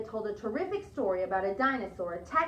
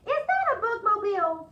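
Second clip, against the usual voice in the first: much higher, louder amazed ask; surprised question; 10 semitones higher, 7 dB louder than usual. Acted. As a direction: sound amazed at the gift. Missus Page surprised.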